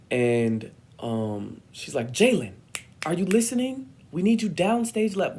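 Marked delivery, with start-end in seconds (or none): 2.1-5.4 high, loud, demanding call; mean director. Retold or imitated. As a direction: imitate a scolding stage director. Imitating mean director calling out.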